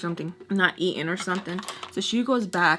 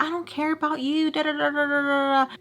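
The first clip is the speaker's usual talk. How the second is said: high-pitched mimicry of dismissive chatter; mock defiant rant; 7 semitones higher than usual. Storyteller mocking someone who claims not to care, high singsong mimicry.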